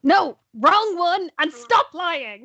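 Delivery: high, slow, emphatic reprimand; high-pitched in-character telling-off; scolding. Acted. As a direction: draw out each word to tell off a companion.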